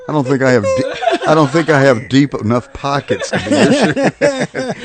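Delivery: words broken by laughter; giggling while answering; laughing. Real, not acted. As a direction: laugh through the reply. Laughing reply to sugar daddy joke.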